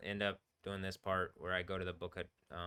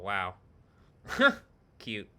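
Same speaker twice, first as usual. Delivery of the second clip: ordinary delivery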